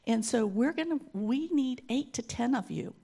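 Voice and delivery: usual speaking voice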